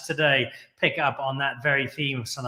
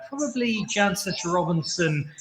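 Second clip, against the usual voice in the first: normal talking voice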